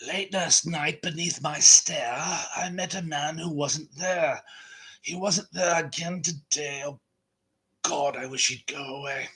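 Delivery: slow, measured, velvety recitation in a put-on film-star voice; James Mason impression. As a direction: recite the poem like a suave old screen villain. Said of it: "prouder of my James Mason".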